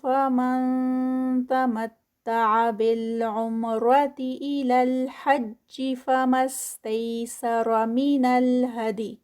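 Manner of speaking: measured Quranic chant; Quran recitation. Shown as a demonstration. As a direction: recite it steadily with full elongation. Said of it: "ذا جو ہے وہ four counts".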